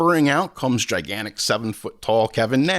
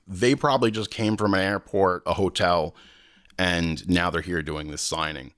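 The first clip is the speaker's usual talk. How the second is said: ordinary delivery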